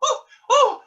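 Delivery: high, loud startled cries. Acted. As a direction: jump at the sudden call. Dame startled by son.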